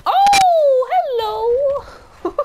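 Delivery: very high, drawn-out greeting. Real, not acted. Player surprised by someone in game, old-woman squeal. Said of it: "I sounded like an old woman".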